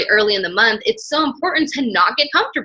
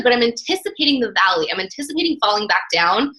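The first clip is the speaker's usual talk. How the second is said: ordinary talk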